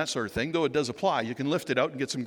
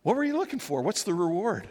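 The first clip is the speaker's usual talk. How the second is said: curious question; 6 semitones higher than usual. Pretend. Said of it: "asking them the question".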